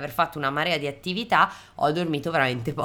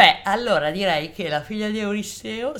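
talking through a yawn; words stretched by a yawn; 5 semitones higher, 5 dB louder than usual. Real, not acted. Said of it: "scusate, uno sbadiglio". (parlando mentre sbadiglia)

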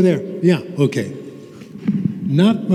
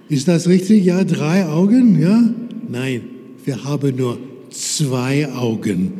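simple teacher talk. Shown as a demonstration. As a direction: coax the class along word by word. Teaches German with simple words.

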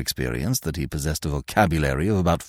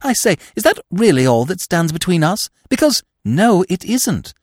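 higher, louder jubilant rush; 10 semitones higher, 4 dB louder than usual. A narrator's reading. Freddy suddenly hopeful about marrying Eve, jubilant and higher. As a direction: burst out in sudden joy. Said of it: "a strange note of jubilation".

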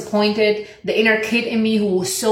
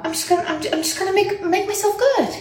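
squeaky high treat-yourself voice; 12 semitones higher than usual. Real, not acted.

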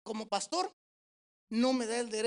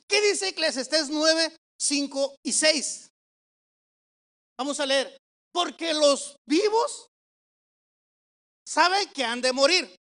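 slow, loud Bible reading; forceful, drawn-out pulpit delivery; emphatic scripture preaching; 4 semitones higher, 7 dB louder, slower than usual. Real, not acted. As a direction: proclaim the verse to the congregation. Preacher reads scripture to congregation.

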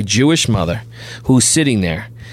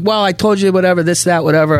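dismissive brush-off; 7 semitones higher than usual. Retold or imitated. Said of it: "his tone changed right away".

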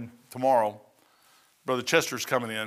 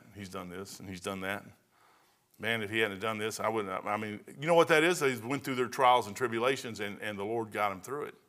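normal talking voice

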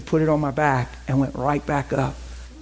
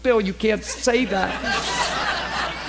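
laughing retort; 4 semitones higher, much slower than usual. Retold or imitated. Teasing retort to friend.

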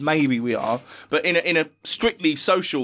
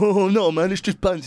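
mock pleading guy; 6 semitones higher than usual. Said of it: "And he's like".

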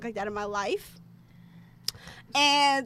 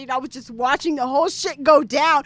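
normal talking voice

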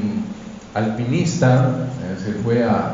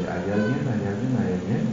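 softer, fading repeats like an echo; 6 semitones lower, 8 dB quieter than usual. A narrator's reading. The mountain echoes the climber's call, soft fading echo. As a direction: let each repeat die away softer. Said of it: "solo escucha el eco".

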